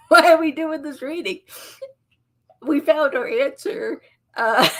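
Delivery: high and loud, laughing through the questions; laughing disbelief. Real, not acted. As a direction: crack up at how pointless the reading is. Laughing at the obvious answer.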